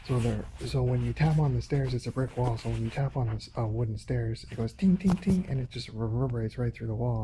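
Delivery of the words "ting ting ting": imitated ting. Imitating taps on the stairs.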